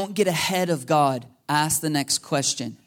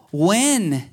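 much higher and louder, whooping and laughing; overexcited shout; 13 semitones higher, 6 dB louder than usual. Retold or imitated. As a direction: burst out like you just got the green light. Retelling rushing to quit his job.

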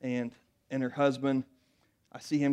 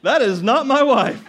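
louder and higher, laughing in shock; laughing disbelief; 9 semitones higher, 13 dB louder, much slower than usual. Retold or imitated. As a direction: laugh it out, stunned by the change. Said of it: "I'm like".

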